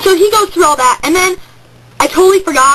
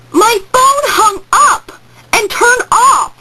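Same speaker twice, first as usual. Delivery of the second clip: exasperated; 7 semitones higher, much slower than usual. Real, not acted. Phone dies mid-call, dramatic.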